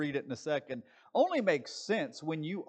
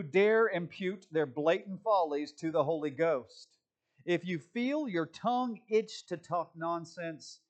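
emphatic, raised preaching delivery; forceful quote reading; 2 semitones higher, 4 dB louder than usual. Acted. Pastor reads Spurgeon's attack on false revelations, forceful and scornful. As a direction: read it as a stinging rebuke, punching key words.

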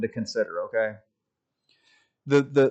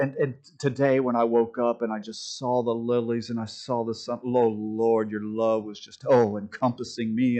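imitating flowery prayer, dropped low and hushed; mock pious prayer; 4 semitones lower, 3 dB quieter than usual. Preacher mimicking silly prayers in church circles, mock pious. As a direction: send up an over-reverent, gushing prayer. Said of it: "sometimes our prayers get a little sil".

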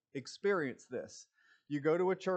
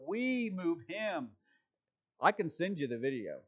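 usual speaking voice

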